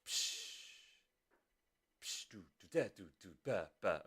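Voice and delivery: quiet, low drum-beat syllables; vocalised drum pattern. Shown as a demonstration. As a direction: sound out the drum rhythm softly to yourself. Voicing a drum rhythm.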